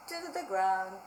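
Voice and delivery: plain reading voice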